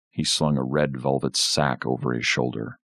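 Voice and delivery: usual speaking voice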